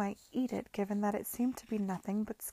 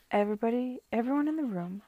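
ordinary delivery

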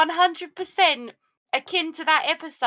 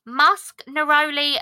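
ordinary delivery

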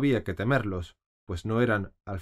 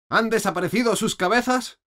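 much higher, loud bellowed question; bellowing; 12 semitones higher, 6 dB louder than usual. A narrator's reading. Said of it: "vociferó la reina". (la reina vocifera, muy alta y aguda)

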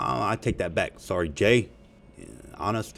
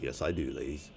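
ordinary delivery

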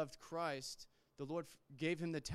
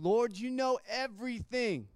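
higher and louder, exasperated; aggravated; 7 semitones higher, 5 dB louder than usual. Acted. Peter, asked a third time, answers Jesus aggravated. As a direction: push back, fed up with the repeated question. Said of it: "he's a little aggravated".

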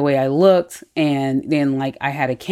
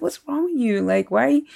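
exasperated yell acted out at a much higher pitch; retold yelling; 10 semitones higher than usual. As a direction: imitate a husband telling off his wife. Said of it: "he starts yelling at his wife".

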